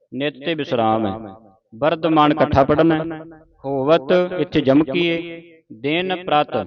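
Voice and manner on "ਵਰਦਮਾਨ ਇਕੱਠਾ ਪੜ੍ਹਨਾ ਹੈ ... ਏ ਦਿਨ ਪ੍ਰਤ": normal talking voice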